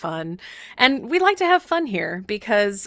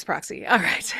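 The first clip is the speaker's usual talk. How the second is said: usual speaking voice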